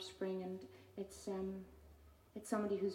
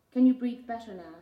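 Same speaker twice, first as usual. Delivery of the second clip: loud taunt; 3 semitones higher, 15 dB louder than usual. Acted. Taunting refrain of poem.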